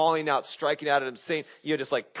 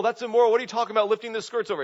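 high, loud, rushed outrage; outraged objection; 8 semitones higher, 6 dB louder, much faster than usual. Retold or imitated. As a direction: fire back indignantly, pitch jumping up. Acting out a shocked objector.

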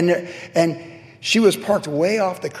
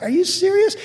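much higher, disbelieving squeak; incredulous. Retold or imitated. Pastor reacting to police being called, incredulous. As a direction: pitch jumps up in disbelief. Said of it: "And I'm like".

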